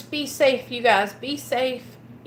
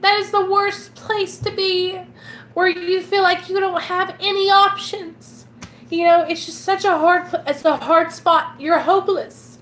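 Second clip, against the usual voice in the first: getting emotional; 6 semitones higher than usual. Real, not acted. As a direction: pitch rising higher as the feeling wells up. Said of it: "I'm gonna get emotional".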